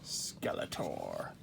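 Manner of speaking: drawn-out, theatrical villain title; mock-ominous character reveal; dramatic villain introduction. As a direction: announce the villain with slow, mock menace.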